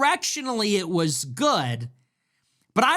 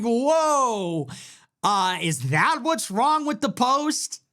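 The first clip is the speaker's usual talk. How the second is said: incredulous, laughing, a bit slower; laughing disbelief; 4 semitones higher, slower than usual. Retold or imitated. Host retelling his own reaction to Tucker, laughing and incredulous. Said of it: "I'm like".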